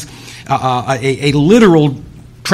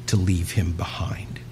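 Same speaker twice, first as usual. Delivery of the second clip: soft, lower whisper fading out; 12 semitones lower, 13 dB quieter than usual. Real, not acted. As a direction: breathe it out as a grave whisper. Preacher drops to solemn close.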